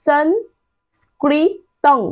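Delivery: সংস্কৃতং drawn out very slowly, the first anusvara as ন; anusvara spelled out slowly. Shown as a demonstration. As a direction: sound out সংস্কৃতং syllable by syllable, very slow. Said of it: "দেখো আমরা কি উচ্চারণ পাবো?". (অনুস্বর ধীরে ধীরে উচ্চারণ)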